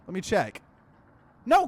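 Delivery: ordinary talk, nothing marked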